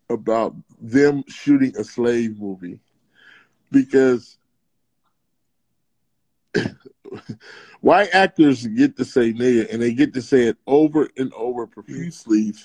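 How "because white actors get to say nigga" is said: ordinary talk, nothing marked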